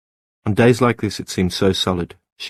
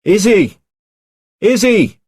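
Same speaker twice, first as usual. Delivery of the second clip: high, loud calls across the island; urgent far-carrying shout; yelling; 13 semitones higher, 10 dB louder, slower than usual. Acted. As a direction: yell her name from the lighthouse gallery. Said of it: "he yelled".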